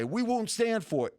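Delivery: high, mock-outraged protest; indignant fan. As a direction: play the fed-up fan. Imitating indignant fans.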